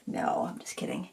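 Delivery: soft whispered "just kidding". Real, not acted. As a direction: take it back in a hushed whisper. Whispered joke aside.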